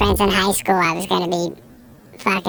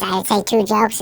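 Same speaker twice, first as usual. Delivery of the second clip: ordinary talk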